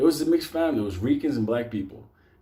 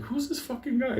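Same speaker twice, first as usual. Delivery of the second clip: puzzled mourners; 13 semitones higher, 4 dB quieter than usual. Retold or imitated.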